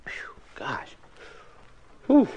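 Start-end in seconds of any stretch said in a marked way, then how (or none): 0.1-1.0 hot drink gasp. Real, not acted. Sipping scalding hot coffee.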